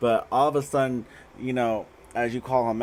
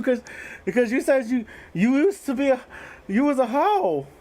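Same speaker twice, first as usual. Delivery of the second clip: much higher, louder mock voice of the man she's dating; high mocking suitor voice; 5 dB louder than usual. Reader voicing the suitor hearing she was a hoe, high and mocking. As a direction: squeak it out like a shocked boyfriend.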